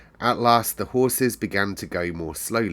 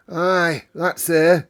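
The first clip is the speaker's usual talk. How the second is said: high, loud Yorkshire housekeeper; Yorkshire accent; 8 semitones higher, 6 dB louder, much slower than usual. Acted. Medlock answers the station master in Yorkshire. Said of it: "speaking with a Yorkshire accent herself".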